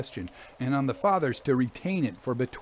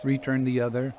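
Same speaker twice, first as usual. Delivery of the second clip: ordinary delivery, nothing marked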